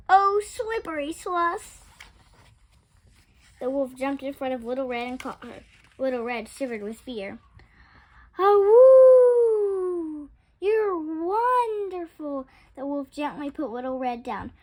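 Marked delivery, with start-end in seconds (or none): 0.1-1.8 higher, louder, slowed alarmed cry. A narrator's reading. Little Red losing her skate cries out in alarm. 8.4-12.5 loud high howl then slow awed praise; delighted wolf. A narrator's reading. The wolf, catching Little Red, howls in delight.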